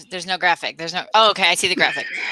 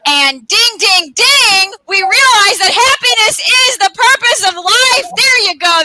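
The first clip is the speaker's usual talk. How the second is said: high, loud ding ding reveal; louder, pitched-up grand finale; triumphant; 8 semitones higher, 5 dB louder than usual. Acted. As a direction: announce the big answer in triumph. Triumphant story climax.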